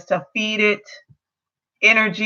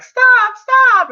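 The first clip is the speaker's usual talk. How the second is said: put-on much higher and louder child's shout; child yelling stop; 7 dB louder than usual. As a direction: scream it like a kid breaking up a fight. Little boy stops adults fighting.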